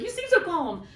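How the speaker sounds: high, pointed accusing repeat; accusatory. Retold or imitated. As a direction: throw it at her like a charge. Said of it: "almost accusatory".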